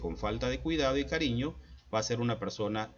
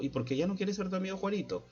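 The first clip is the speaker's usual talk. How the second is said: quick, much higher questioning mother; mother asking; 9 semitones higher, faster than usual. Retold or imitated. Mother asking Juanito why his friend left, quick and high. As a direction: press gently and fast for the reason.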